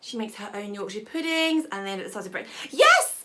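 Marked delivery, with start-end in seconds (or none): 2.7-3.2 excited yes. Real, not acted. Cheering reply to a comment.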